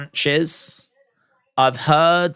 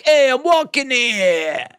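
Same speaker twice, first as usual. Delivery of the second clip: put-on slowed, higher New York street accent; 9 semitones higher than usual. Streamer riffing on New York, slow higher New York accent. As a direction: bark it like a New Yorker cabbie.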